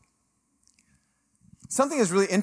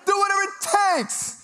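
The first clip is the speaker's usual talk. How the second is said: whiny pleading. Retold or imitated. Broker begging mom to make Juan act, wailing. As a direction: beg like a desperate kid, hammed up.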